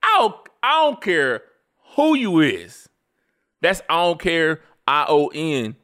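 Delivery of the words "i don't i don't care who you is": high, loud and punched, falling hard; hammed-up indignant insistence; emphatic slang declaration. Shown as a demonstration. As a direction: lay down the law, loud and high. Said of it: "I'ma say it like that".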